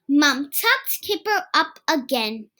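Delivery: ordinary delivery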